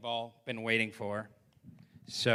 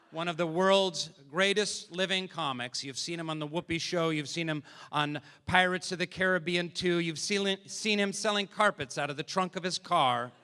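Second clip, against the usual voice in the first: raised announcer voice building to a loud shout; announcer introduction; 4 semitones higher, 6 dB louder than usual. Real, not acted. Emcee introducing a great comic, big announcer build. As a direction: build up to a belted name.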